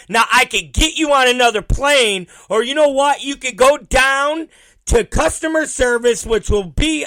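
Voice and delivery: loud, high-pitched mock agent tirade. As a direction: imitate an agent shouting a passenger down. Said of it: "She starts raising her voice".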